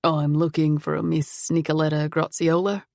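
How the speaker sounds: put-on refined foreign accent, not quite British. A narrator's reading. Stranger at the door introducing himself, refined foreign accent. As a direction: introduce yourself with a vaguely European accent. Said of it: "He had an accent".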